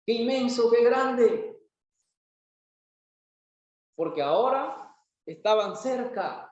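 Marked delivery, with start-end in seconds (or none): 0.0-1.6 put-on higher-pitched childlike amazement; lightly acted wide-eyed wonder; surprised child. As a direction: marvel like an awed little boy. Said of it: "el niño sorprendido le dice".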